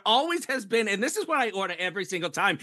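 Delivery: high, indignant mock New York accent; hammed-up New York customer griping. Woman back from arguing over bagels, indignant New York accent. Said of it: "That's a great accent too".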